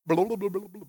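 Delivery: mock underwater burbling; drowning gurgle. Preacher pretending he drowns with the disciples, comic gurgling. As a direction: babble like someone going under water.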